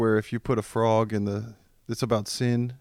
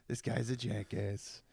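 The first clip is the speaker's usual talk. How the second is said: very soft, low and slow; muttered repeat; 4 semitones lower, 16 dB quieter than usual. Acted. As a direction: say it slowly under your breath. Repeats the bit under breath.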